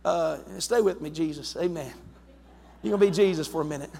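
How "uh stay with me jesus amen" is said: soft, low, hushed prayer murmur; quiet praise. Shown as a demonstration. Preacher showing the church his quiet private praise. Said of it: "this is how I, I praise him".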